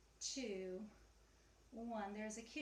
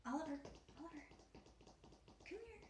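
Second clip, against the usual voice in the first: calling the cat; 6 semitones higher, 7 dB quieter, faster than usual. Real, not acted.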